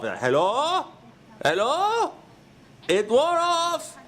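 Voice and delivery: squeaky, dazed creature voice, laughing through it; high, giddy monster voice. Retold or imitated. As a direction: play a freshly unfrozen monster, bewildered and high. Voicing a just-unstoned monster.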